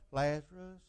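soft, weak murmur of the name "Lazarus"; timid quiet call. Shown as a demonstration. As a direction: say "Lazarus" meekly, barely audible. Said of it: "He didn't do it like, eh, people go".

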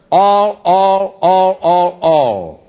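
all counted out, each one punched; repeating all. Shown as a demonstration. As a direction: drive home every all, one by one. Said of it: "how many times that word all occurs".

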